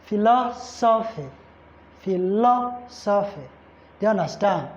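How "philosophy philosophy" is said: "philosophy" broken up, LO held and stressed. Shown as a demonstration. Teacher drilling the stressed syllable, LO stressed in "philosophy". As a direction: say "philosophy" deliberately, leaning on LO. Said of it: "is also going to be on law".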